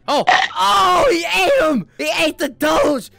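high, loud shocked cry; real surprise at the screen. Real, not acted. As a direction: blurt it out in disbelief. Reacting to avatar eating.

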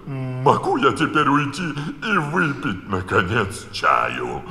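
a hint of the nervous Hatter pleading; anxious Hatter. A narrator's reading. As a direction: ask to leave with a slow drawl. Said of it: "обеспокоенно поглядывая на королеву".

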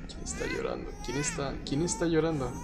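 hushed, obsessive repetition; quiet dread, fading to a squeak; scared muttering. Real, not acted. Hears crying, dreads looking.

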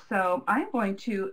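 usual speaking voice

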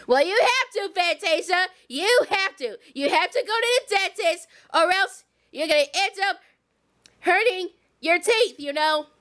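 deep motherly warning; Harriet voice. Pretend. Harriet voice again.

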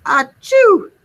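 huge, high, loud achoo; gigantic sneeze. A narrator's reading. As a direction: blast out a giant sneeze. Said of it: "sneezed a gigantic".

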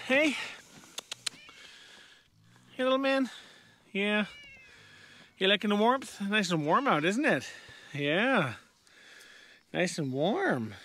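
high, gentle pet talk; cooing to the cat. Real, not acted. Owner greeting his cat in a high, cooing voice.

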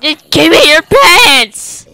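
loud demand. Pretend. Demanding loot.

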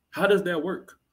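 ordinary talk

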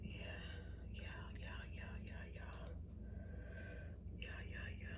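softly whispered to herself. Real, not acted. Murmuring to herself while doing her edges, whispered. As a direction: whisper it under your breath.

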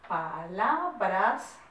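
very slow, drawn-out syllables in "palabras"; syllable-by-syllable dictation. Shown as a demonstration. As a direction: stretch "palabras" out, rising at the end.

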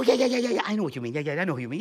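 ordinary talk

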